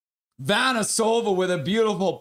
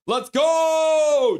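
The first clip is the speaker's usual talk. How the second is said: higher, louder cheer; hype shout; 10 semitones higher, 5 dB louder than usual. Real, not acted. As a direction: yell it for the team. Cheering a goal.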